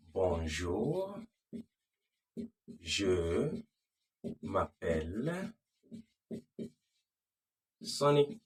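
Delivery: slow, with long pauses between words; slow demo. Shown as a demonstration. French teacher demonstrating his introduction slowly. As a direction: drag it out word by word for learners. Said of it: "That is a very fast way".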